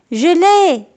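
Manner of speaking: little victory yelp; triumphant cry. A narrator's reading. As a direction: shout out the win. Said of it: "s'écrie-t-il".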